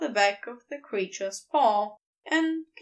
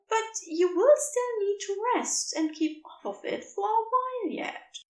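higher, gently worried caretaker; concerned creature voice; 9 semitones higher than usual. A narrator's reading. Creature cautioning Crowley about his ankle, gently worried higher voice. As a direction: fuss over his ankle kindly.

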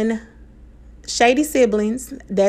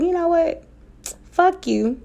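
higher, breezy kiss-off with a laugh; dismissive brush-off; 8 semitones higher than usual. Retold or imitated. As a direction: toss it off lightly, done with him. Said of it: "I'm just like".

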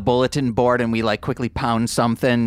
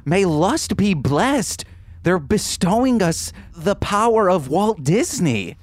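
excited; 6 semitones higher than usual. Acted. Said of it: "I'm like".

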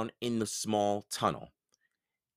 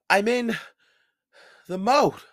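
gasping for air; 11 semitones higher, 6 dB louder than usual. A narrator's reading. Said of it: "gasping for air".

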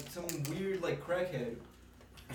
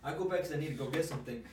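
normal talking voice

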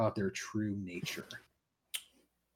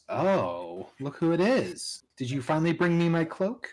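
taunting villain; 6 semitones higher, 5 dB louder than usual. Acted. GM voicing a taunting NPC.

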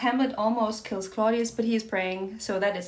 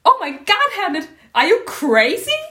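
high loud shocked cry; shocked Gertrude; 9 semitones higher, 11 dB louder than usual. Acted. Gertrude shocked at Hamlet, high and loud. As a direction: shriek it at your son.

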